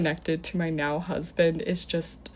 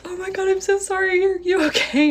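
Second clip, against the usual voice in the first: very high, squealed frantic apology; imitated panicked apology; 13 semitones higher, 4 dB louder than usual. Date to woman after crashing the car, mock-panicked apology. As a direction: mimic a man panicking after his mistake.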